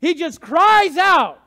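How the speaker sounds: loud, high, stretched emphasis; crying out. Shown as a demonstration. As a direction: belt out the words, drawing them long. Voicing how the leper cried out.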